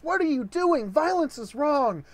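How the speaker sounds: mock-innocent high voice calling for peace; pleading sister. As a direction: beg him to stop in a hippie peace tone. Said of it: "pulls the hippie dressed son off of the father and says".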